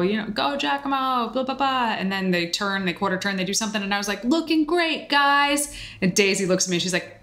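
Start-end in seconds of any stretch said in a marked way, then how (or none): 0.3-1.9 cheering on. Retold or imitated. Cheering for Giacomo from the audience, mock cheer. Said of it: "first I'm cheering on Giacomo". 4.2-5.7 high-pitched retold cheer; encouraging cheer. Encouraging the other competitors from the audience, retold high cheer. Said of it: "first I'm cheering on Giacomo".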